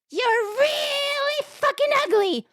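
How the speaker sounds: very high, slow parrot screech; talking parrot. Acted. Parrot insults a passing lady, high parrot squawk. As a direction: squawk it high and drag the vowels out. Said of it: "Parrot says".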